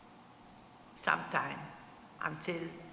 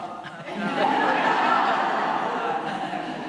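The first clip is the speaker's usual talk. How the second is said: real laughter, much louder, held long; 2 semitones higher, 10 dB louder than usual. Real, not acted. Survivor laughing loudly about her tall father.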